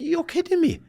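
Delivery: high, stunned you're-kidding-me; disbelief. Retold or imitated. Coach on the phone hearing the signing, stunned and high. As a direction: squeak it out in shock.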